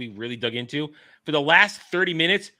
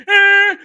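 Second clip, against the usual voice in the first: high, loud hammed-up operatic shriek; loud mock shriek; mock singing wail; 14 dB louder than usual. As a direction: belt it like a bad diva. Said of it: "And then she went".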